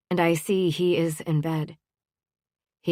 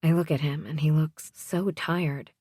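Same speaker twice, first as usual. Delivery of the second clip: ordinary delivery, nothing marked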